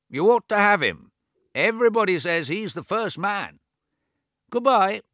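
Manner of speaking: put-on high, loud old man voice; deaf old man. James takes his leave.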